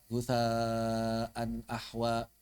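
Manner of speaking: "gusaan ahwa" dragged very slowly and low, lifeless; imitating a bad reading. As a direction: mimic a student reading "gusaan ahwa" flat and drawn out. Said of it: "kalau baca itu". (meniru peserta membaca "gusaan ahwa" datar dan lambat)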